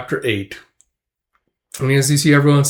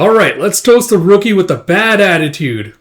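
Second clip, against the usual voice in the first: loud, raised-pitch rallying call; hearty toast; 6 semitones higher, 6 dB louder than usual. Retold or imitated. Comrade toasts the rookie to the crowd, loud and hearty.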